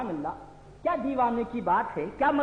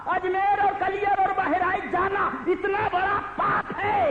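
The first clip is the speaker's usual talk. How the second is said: mock outraged shout; 9 semitones higher than usual. Preacher mocking rivals with a raised shout. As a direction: shout their accusation back in mockery.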